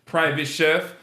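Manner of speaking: drawn-out high announcer build-up; announcer introduction. Acted. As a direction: bring the guest on like an MC. Introducing the guest.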